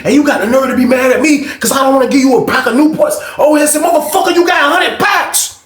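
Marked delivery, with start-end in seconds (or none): 0.1-3.2 high, outraged retold accusation; arguing. 3.8-5.6 very high mimicked outraged cry; arguing. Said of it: "They was on that bitch arguing".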